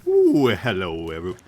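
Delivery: slow, drawn-out hammy hello; mock YouTuber greeting; mimicking an intro. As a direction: stretch out a copy of the greeting. Mimicking Scar's old intro.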